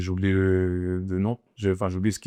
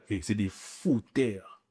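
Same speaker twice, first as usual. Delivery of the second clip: drawn-out, softer "fouteurs"; voix douce; 7 dB quieter, much slower than usual. Real, not acted. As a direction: stretch out "fouteurs" slowly, without shouting. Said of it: "je me le dis avec une voix douce". (« fouteurs » très étiré, d'une voix douce)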